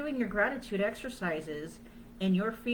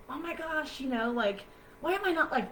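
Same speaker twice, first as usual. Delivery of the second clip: high, bursting gasp of delight; mock-thrilled squeal; excited; 7 semitones higher than usual. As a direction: gush like you just saw the best thing. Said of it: "head up, chest out".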